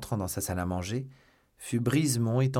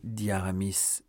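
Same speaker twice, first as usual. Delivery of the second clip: usual speaking voice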